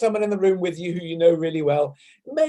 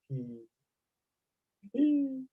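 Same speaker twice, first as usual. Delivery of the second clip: soft he, he, the second much higher; light he sound; 7 semitones higher, 9 dB quieter than usual. Shown as a demonstration. Teacher with fingertips on head, light he, he. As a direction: lightly hum a he through the head. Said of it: "we'll just very lightly go".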